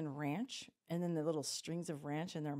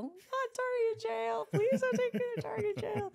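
very high, louder pleading wail; mimicked panicked child; 4 dB louder than usual. Toddler begging mom not to jail her, high wailing mimicry. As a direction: beg in a squealing mock-toddler cry.

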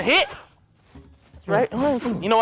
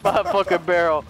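laughing taunt; 4 semitones lower than usual. Real, not acted. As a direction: crow it while cracking up. Gloating at partner's mishap.

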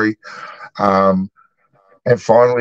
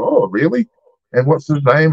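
high, intrigued imitation; retold keen interest; 6 semitones higher than usual. Pub worker asking lady about her cousin, keenly. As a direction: pitch it up like an eager enquirer. Hooked friend learns of jiu-jitsu cousin.

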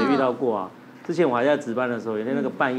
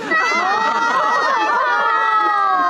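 long loud high laugh; a high shrieking laugh that carries on; 5 dB louder than usual. Real, not acted. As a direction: howl with laughter. Laugh at scary joke.

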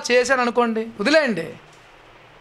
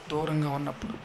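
hushed emphasis; 7 semitones lower, 11 dB quieter than usual. Real, not acted. Preacher drops voice on key point.

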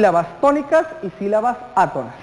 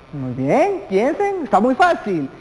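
usual speaking voice

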